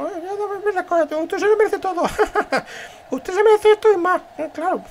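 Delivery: very high squeaky put-on voice; fawning admirer. Admirer telling the leader he deserves everything, high squeaky fawning. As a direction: gush in a tiny high voice.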